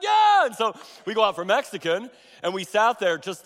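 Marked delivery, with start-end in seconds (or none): 0.0-0.5 high, loud happy shout of yes; enthusiastic yell. Retold or imitated. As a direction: shout it out gleefully. Husband's enthusiastic answer to wife.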